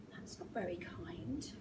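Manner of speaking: gasp, then a soft whisper; whispered, low-volume reproach after a gasp; hushed dismay. A narrator's reading. Narrator reacts to the animals' teasing, hushed dismay.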